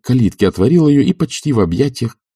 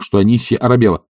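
ordinary delivery

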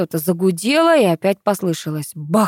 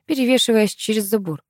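ordinary delivery, nothing marked

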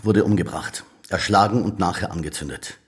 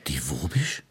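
whispered disbelief; 6 semitones lower, 8 dB quieter than usual. Acted. Detective reacts in disbelief.

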